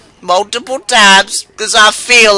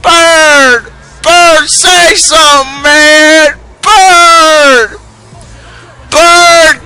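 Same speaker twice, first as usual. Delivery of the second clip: raised, higher-pitched yell for attention; calling out; 6 semitones higher, 3 dB louder, much slower than usual. Real, not acted. Player calling friend in headphones, insistent holler. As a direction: holler at a friend who isn't listening.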